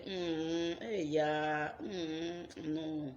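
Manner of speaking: scoffing hey yeah, brushing someone off; mock the struggler with a dismissive hum; dismissive mockery. People mocking her struggle with dismissive scoffing. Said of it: "they will not be saying".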